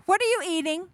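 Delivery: high, loud, suspicious question; nosy sister. Acted. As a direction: pounce on it like a prying sister. Acting nosy sister sniffing out candy.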